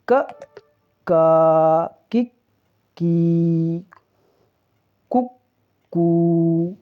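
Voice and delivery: short क cut brief, long का held out; teacher chanting the syllables from क to कहा, separately; syllable recitation. Shown as a demonstration. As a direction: recite क, का, कि, की one by one. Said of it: "अब हम जान लेते हैं".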